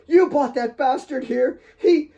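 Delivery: high, loud sobbing accusations; hysterical sobbing. A narrator's reading. Kate sobbing hysterically at Mary about Alex's murder. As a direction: wail it through tears, half screaming. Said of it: "She broke free and lunged at Claire".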